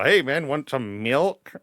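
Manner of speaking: "milk" said as "melk", slow. Shown as a demonstration. [name] shows how he says milk in a sentence, melk. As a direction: offer "milk" saying "melk". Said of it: "I gotta go".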